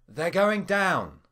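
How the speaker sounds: slow, high, strained through gritted teeth. A narrator's reading. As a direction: force it out through clenched teeth. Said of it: "I said through gritted teeth".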